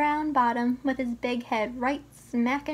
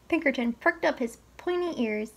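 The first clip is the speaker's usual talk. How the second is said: ordinary delivery, nothing marked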